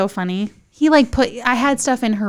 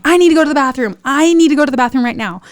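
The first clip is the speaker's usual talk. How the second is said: loud, high urgent demand; mock yell of desperation; retold yelling; 4 semitones higher, 5 dB louder, faster than usual. Woman in labor needing bathroom, yelling. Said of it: "what I was yelling the entire time".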